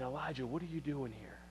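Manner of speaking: barely audible, low and hushed; quiet whisper. Acted. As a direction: drop right down to a still small voice. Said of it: "in a quiet whisper".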